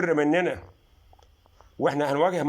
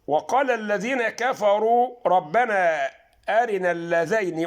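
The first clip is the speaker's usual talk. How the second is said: slow, louder chanted recitation; Quran recitation; 5 semitones higher, 4 dB louder, slower than usual. Shown as a demonstration. Teacher recites Fussilat verse to viewers, slow chant. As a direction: chant the verse out, drawn long.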